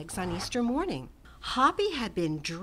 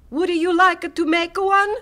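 loud, high, distinct inventor voice; Roberto inviting; 10 semitones higher, 13 dB louder than usual. A narrator's reading. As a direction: offer it eagerly. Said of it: "Roberto asked".

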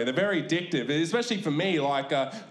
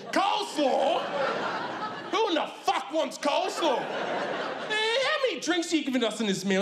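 high, incredulous outburst at the coleslaw; dad blowing up at the counter, pitched way up; outraged dad; 13 semitones higher than usual. Retold or imitated. Dad to KFC lady, high outraged disbelief. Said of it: "dad outraged at coleslaw".

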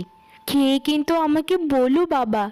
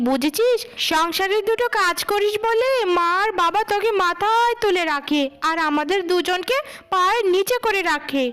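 put-on higher-pitched, indignant young woman talking back; angry elder sister; 6 semitones higher than usual. Eldest daughter protesting her mother's scolding, higher and indignant.

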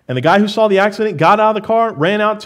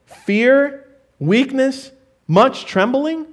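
each word higher and slow; quoted words stressed; 7 semitones higher, much slower than usual. Acted. Preacher quotes Paul's words to the church, higher and slow.

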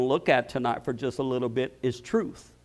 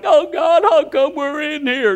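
high, loud mock wail to God; hammed-up lament, much higher and louder; mock crying out; 13 semitones higher, 9 dB louder than usual. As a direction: wail it like a complaining prisoner. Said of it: "And they're crying".

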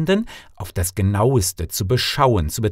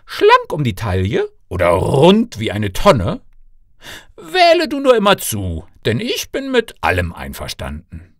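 higher, lively royal father listing choices; generous father, questions swinging up high; fond king father; 7 semitones higher, 3 dB louder than usual. Acted. King offers daughter any husband.

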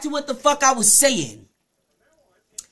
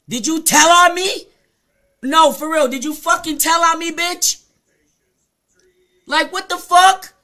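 loud, higher-pitched outraged demand; angry confrontation; 4 semitones higher, 6 dB louder than usual. Real, not acted. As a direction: confront them, voice raised in real anger.

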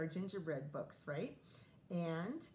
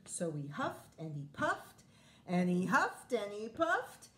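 building dramatic narration; 8 dB louder than usual. A narrator's reading. Narrating wolf's huffing building.

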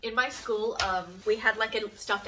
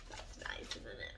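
whispered aside; 14 dB quieter, slower than usual. Real, not acted. Quiet aside after reading letter.

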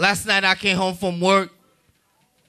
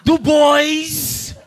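high, camp calling-out voice; flamboyant doctor; 7 semitones higher, 4 dB louder than usual. Retold or imitated. Flamboyant doctor calling for a patient, flamboyant. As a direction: call the name out high and showy. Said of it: "real flamboyant, came out the back, he was like".

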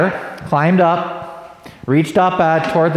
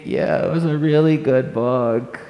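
sleepy; 3 semitones lower, 4 dB quieter than usual. Acted. Tired girl to mom.

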